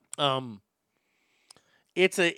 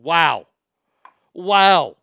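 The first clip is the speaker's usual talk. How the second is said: exclaimed wow; 5 semitones lower, 5 dB louder than usual. Shown as a demonstration. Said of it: "all you gotta say is wow".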